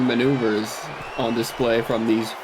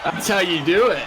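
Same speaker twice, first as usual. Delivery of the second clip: excited shout; 6 semitones higher than usual. Real, not acted. Cheering a big move.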